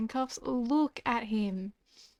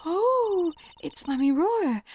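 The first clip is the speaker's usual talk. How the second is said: higher, louder excited gasp; light up at the sight of her; starstruck; 7 semitones higher, 5 dB louder, slower than usual. Acted. Fan spotting the singer, starstruck and high.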